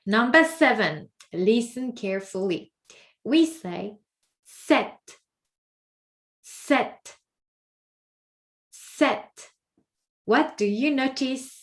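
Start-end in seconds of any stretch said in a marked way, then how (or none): 4.7-9.5 sept said as set with no P; sept, drop the P entirely; sept, P silent. Shown as a demonstration. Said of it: "We do not pronounce the P".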